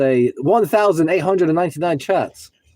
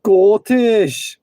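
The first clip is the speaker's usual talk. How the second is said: mock Scottish; 6 semitones higher, much slower than usual. Mock accent play.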